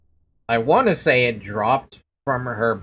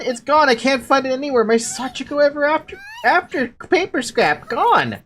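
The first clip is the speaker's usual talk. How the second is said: higher and louder, panicked; dismayed cry; 11 semitones higher, 5 dB louder than usual. Acted. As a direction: realise it's truly lost. Charm lost, despair.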